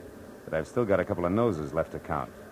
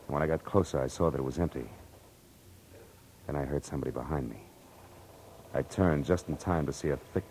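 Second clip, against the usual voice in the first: tense noir narration; 4 semitones lower, 5 dB quieter than usual. A narrator's reading. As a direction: build the dread as he creeps inside. Detective narrates the scene.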